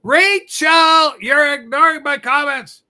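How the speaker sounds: loud mock complaint from a viewer; loud complaining comment. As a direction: read the comment like an annoyed viewer.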